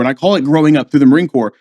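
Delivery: normal talking voice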